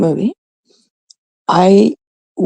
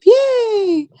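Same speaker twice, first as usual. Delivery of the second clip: very high, hammed-up fake yay; mock cheer. Comic mock-celebrates the March break trip, high fake cheer. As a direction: cheer with sarcasm.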